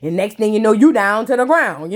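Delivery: low teasing warning to Cole Killer; low, knowing warning. Pretend. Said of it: "I said".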